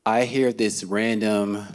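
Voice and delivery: plain reading voice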